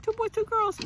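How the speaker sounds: put-on high, sing-song hopeful lilt on "Two boys, two girls"; hopeful wish. Mother recalling her hope for the babies, in a high hopeful lilt. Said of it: "I was hoping it was a girl".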